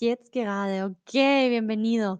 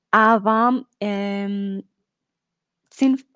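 ordinary delivery

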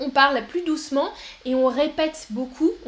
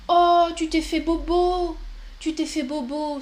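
put-on baby talk to a child; 4 semitones higher than usual. Shown as a demonstration. Teacher cooing to a hurt child in baby talk. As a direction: soothe a little one who fell.

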